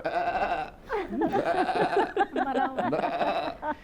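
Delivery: two laughing bleats; goat bleat. Retold or imitated. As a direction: bleat twice, breaking into a laugh. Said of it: "वो ऐसे होगा".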